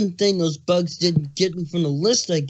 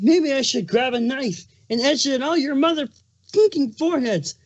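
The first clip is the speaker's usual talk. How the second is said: menacing, higher-pitched threat; angry threat; 7 semitones higher than usual. Acted. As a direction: threaten them, seething. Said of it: "his plastered with anger".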